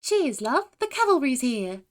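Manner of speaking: high, loud British heroine catchphrase; bouncy British game character; fake British accent. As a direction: announce yourself in perky put-on British. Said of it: "do a fake British accent".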